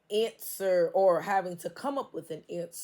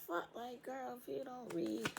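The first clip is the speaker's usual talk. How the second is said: high and soft, flustered; flustered karmic; 7 semitones higher, 9 dB quieter than usual. Retold or imitated. The karmic grumbles high and hushed. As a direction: mutter like a rattled hater. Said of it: "karmic in the background like".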